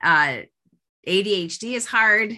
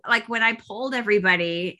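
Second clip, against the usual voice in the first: ordinary talk, nothing marked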